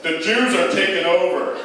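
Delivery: lightly voiced bigoted old man. Old grandfather answering about his winter, grumbling loudly. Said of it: "And he's like".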